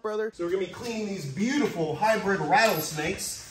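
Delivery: normal talking voice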